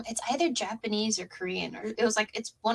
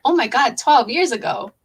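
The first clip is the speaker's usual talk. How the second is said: excited disbelief; 5 semitones higher, 9 dB louder than usual. Retold or imitated. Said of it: "And I was like".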